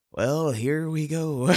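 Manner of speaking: slow, high, resigned sigh of a voice; hammed-up weary teacher; reluctant teacher. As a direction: drag it out like a teacher dreading the lesson. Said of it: "opening the book and go".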